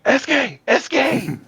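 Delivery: high-pitched, squealing fan call; retold scream. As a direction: scream the name out as if across a crowd. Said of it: "and I screamed out".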